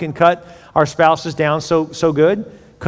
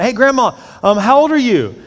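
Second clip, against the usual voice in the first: granddaughter; 6 semitones higher than usual. Retold or imitated. Granddaughter voiced in joke.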